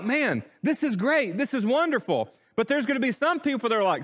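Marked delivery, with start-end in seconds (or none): 0.0-2.3 higher-pitched, mock-excited reaction; imagined excitement. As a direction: act out an amazed onlooker, pitched up. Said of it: "how excited would we be".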